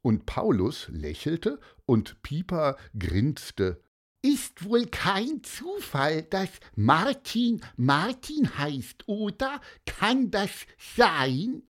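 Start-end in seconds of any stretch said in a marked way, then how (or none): 4.2-11.6 very high, slow character voice; Pieper's squeaky voice. Acted. Pieper wondering about Martin's name, very high and slow. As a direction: pipe it up high, drawing out words.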